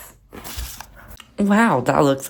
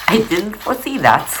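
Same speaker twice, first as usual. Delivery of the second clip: higher, louder mock British voice; bad British accent; 8 semitones higher, 5 dB louder than usual. Crafter owning up to an unforeseen problem, in a bad British accent. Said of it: "sorry for my bad British accent".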